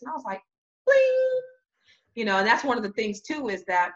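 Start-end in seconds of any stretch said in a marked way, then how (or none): 0.8-1.4 high, loud ringing "bling"; idea chime. Retold or imitated. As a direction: sing it out like a bell going off. Imitates an idea lighting up.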